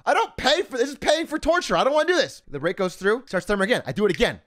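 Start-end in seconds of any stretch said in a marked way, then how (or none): 0.1-2.4 higher-pitched mock complaint; acted protest. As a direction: whine in protest at being put through torture. Acts out his protest at workout.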